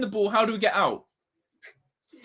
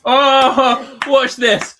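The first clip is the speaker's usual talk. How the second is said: loud burst of laughter; 5 semitones higher, 10 dB louder, much slower than usual. Real, not acted. Laughs at wife's teasing.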